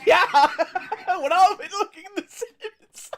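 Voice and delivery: high, loud, talking through laughter; laughing. Real, not acted. Player cracks up over identical hallways, laughing hard. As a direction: crack up while speaking.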